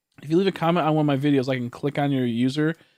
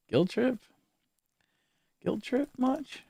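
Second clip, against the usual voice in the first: rising, higher mock guilt trip; teasing; 11 semitones higher, 7 dB quieter, slower than usual. Real, not acted. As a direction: tease the chatter with a sing-song. Teasing chat.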